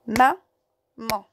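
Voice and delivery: "Maman" coupé en deux syllabes, ma-man; détache bien ma et man. Shown as a demonstration. (maman syllabe par syllabe)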